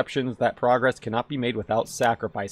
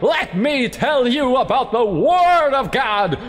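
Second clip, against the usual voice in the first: mock preacher; 10 semitones higher than usual. Gamer pretending to preach about dogs, hammed-up sermon. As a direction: preach it at full force, tongue in cheek.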